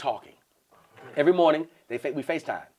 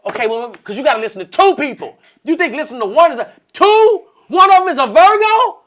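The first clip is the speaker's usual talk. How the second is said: mock exasperation; 10 semitones higher, 9 dB louder than usual. Acting out women talking over him.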